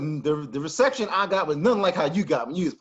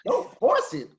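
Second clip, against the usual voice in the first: much higher, baffled repeat; incredulous echo; 12 semitones higher, slower than usual. Real, not acted. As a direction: throw the words back in disbelief. Echoing the coach in disbelief.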